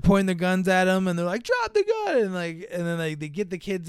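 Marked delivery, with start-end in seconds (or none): 1.4-2.2 high, hammy shouted order; acted high-pitched command; cop's command. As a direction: bark it like a panicked movie cop. Cops with guns order the alien.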